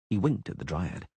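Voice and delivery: ordinary delivery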